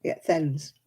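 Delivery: "thens" said with th and no h; Lancashire thens. Shown as a demonstration. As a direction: clip "thens" in a Lancashire accent. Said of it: "Everything has a th".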